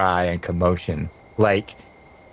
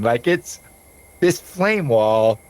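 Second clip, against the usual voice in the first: ordinary talk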